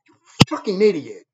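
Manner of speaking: normal talking voice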